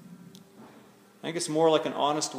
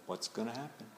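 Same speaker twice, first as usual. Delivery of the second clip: hushed, much softer, as if holding your breath; breathless anticipation; 3 semitones lower, 14 dB quieter than usual. Acted. Crowd waiting on Jesus' response, hushed suspense. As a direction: voice an anxious crowd's held-breath question very quietly. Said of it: "the crowd holding its breath".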